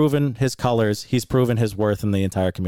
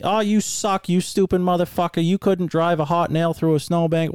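mocking, higher-pitched imitation of a heckler; middle school taunting; 8 semitones higher than usual. Racer imitating his rival's jeers from the truck, middle school taunting. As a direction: mimic a schoolyard trash-talker, voice up. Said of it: "same typical middle school bullshit".

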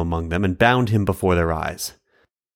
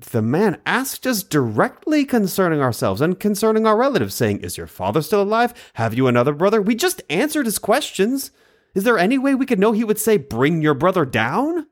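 lightly voiced defensive brothers, higher; 10 semitones higher than usual. Brothers answering their father's reproach, mildly defensive. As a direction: protest your innocence, a touch higher.

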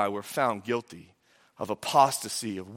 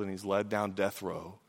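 usual speaking voice

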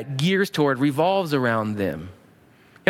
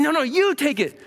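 high-pitched eager protest; generous insistence; 11 semitones higher than usual. Acted. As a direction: insist that the other takes it. Acted friendly tussle over giving.

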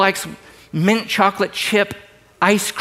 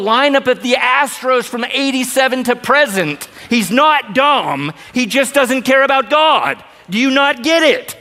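rising rebuke; 7 semitones higher than usual. Real, not acted. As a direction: hammer the point home with a shout. Preacher rebuking.

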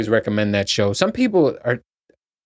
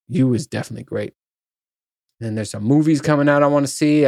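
normal talking voice

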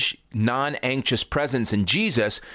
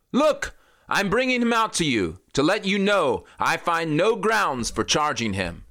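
raised, higher announcing voice; higher-pitched proclamation to the crowd; Pilate announcing; 7 semitones higher than usual. Acted.

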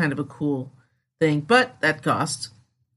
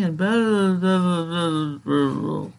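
mock NPR drone; slower than usual. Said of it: "that's my N P R impression".

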